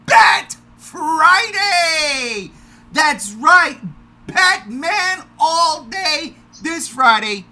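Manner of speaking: loud, high announcer shout; redone hype intro. Acted. Host restarting the podcast intro, shouting it bigger. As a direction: blast the welcome like a showman. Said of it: "Let me do the intro again".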